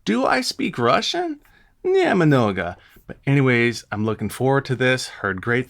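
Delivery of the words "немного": ordinary talk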